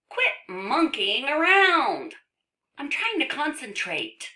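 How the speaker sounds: high, loud comic scolding; annoyed book character. Acted. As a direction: scold in a high-pitched cartoonish voice.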